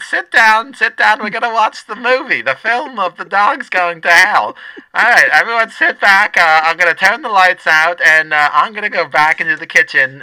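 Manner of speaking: put-on loud, high lunch lady ordering kids; bossy cafeteria lady shouting. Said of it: "I should just do both voices".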